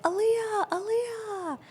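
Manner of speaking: high, sing-out mimic of fans; imitated eager fan calls; fans calling out. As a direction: call her name high, trying to get attention.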